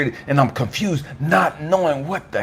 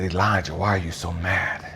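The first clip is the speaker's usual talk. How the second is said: God; 7 semitones lower than usual. Retold or imitated. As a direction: ask it low and calm. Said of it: "I heard God talking to me".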